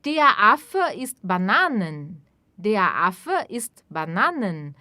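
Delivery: slow, clear dictation pace; slow model sentence. Shown as a demonstration. Teacher reading a sentence slowly for the class. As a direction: read it slowly for students to repeat. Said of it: "teacher models sentence".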